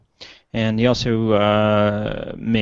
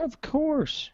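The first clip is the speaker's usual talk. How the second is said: squeaky sarcastic of course; high silly of course; mock high-pitched agreement; 5 dB quieter than usual.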